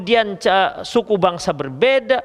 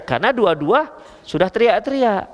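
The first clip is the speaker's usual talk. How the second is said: ordinary delivery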